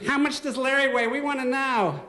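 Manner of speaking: high, teasing classroom taunt; mocking bully. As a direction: jeer like a smug schoolboy.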